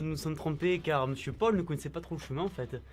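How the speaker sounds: mock interview voice.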